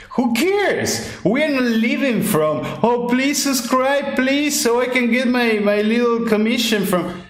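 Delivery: mock a creator begging for subscribers; mock whiny influencer. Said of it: "we said".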